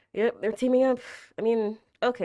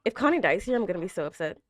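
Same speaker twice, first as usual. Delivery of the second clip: usual speaking voice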